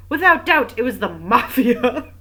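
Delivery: big theatrical reveal; dramatic declaration. A narrator's reading. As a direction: announce the theory with mock drama. Servant's dramatic theory.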